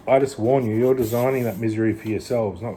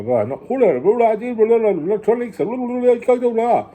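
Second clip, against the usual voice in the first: much higher, louder babbling mockery; mock gibberish presenter; 12 semitones higher, 7 dB louder than usual. Vlogger mocking a tutorial host he can't understand, high loud babble. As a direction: garble it like an unintelligible host. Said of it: "I don't know what you're saying".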